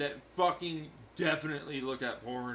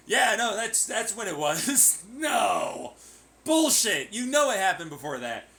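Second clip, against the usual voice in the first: loud, higher disbelieving scoff; 3 semitones higher, 4 dB louder than usual. Real, not acted. Scoffing at someone's lie about sex, loud disbelief. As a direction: call bullshit, loudly.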